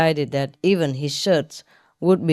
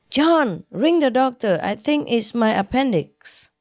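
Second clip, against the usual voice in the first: panicked; 6 semitones higher than usual. Acted. Wife in pain calling husband.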